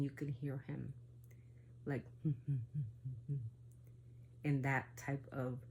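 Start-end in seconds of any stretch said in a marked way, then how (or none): 2.1-3.5 macabre laugh. Retold or imitated. Demon walking off on goat feet, soft macabre laugh. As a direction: laugh like something that isn't human. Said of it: "macabre, weird voice".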